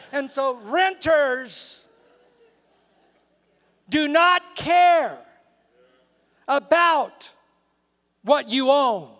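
slow, weighty preaching with long pauses; deliberate, punched-out pulpit delivery; emphatic preaching. Real, not acted. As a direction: stretch out each key word for the congregation.

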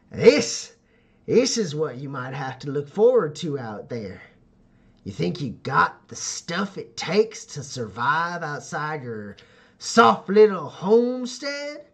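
slow, challenging veteran, rising at the end; hardened rider's slow taunt; tough nomad leader. Acted. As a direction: dare the newcomer, drawing the words out. Tusk shows scars, challenges Joe.

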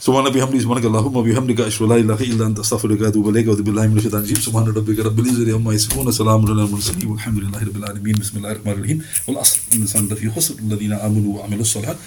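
rapid, low murmured recitation; fast closing dua recitation; closing prayer. Acted. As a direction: rattle the dua off fast and soft.

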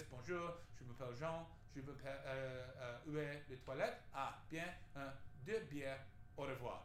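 schoolboy French with a lightly put-on French accent. Shown as a demonstration. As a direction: rattle off tourist phrases in lightly accented French. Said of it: "I can do this".

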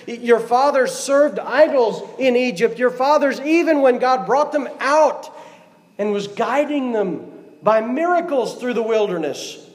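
high-pitched, urgent Joshua address; impassioned preaching. Acted. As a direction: preach it insistently to the people.

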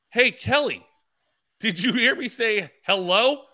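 high, loud, drawn-out call. Retold or imitated. Racer calling to a reporter who walked past, calling out. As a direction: demand to be noticed, pitched well up. Calling again after being ignored.

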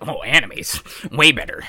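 lightly voiced snobby anime fan; a hint of an anime purist sneering; neckbeard voice. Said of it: "I love it when you do your neckbeard impression".

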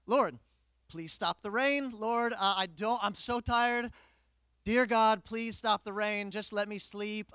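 pleading loudly to God, exhausted; desperate loud prayer. Retold or imitated. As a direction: beg God at the top of your voice. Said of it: "I start praying".

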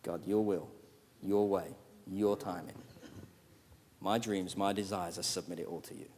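hushed submissive prayer; humble surrender. Retold or imitated. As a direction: pray it in quiet surrender. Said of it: "submitting to him says".

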